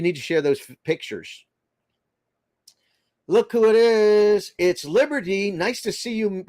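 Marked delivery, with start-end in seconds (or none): 3.3-6.4 high, loud, drawn-out welcome; excited greeting. Real, not acted. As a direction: greet a friend who just showed up. Greeting a friend arriving in chat.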